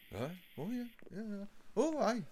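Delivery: soft, high mock Welsh murmur; quiet, lilting Welsh agreement; imitated Welsh accent. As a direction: imitate a laid-back Welshman, softly. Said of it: "and he's like".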